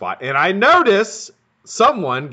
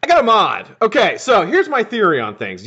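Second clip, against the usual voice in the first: higher, louder burst of excitement; excited announcement; 9 semitones higher, 5 dB louder than usual. Real, not acted. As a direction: announce the new mod eagerly. Excited new topic announcement.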